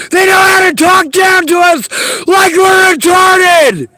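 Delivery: furious shout. Real, not acted. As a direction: yell it out, fed up.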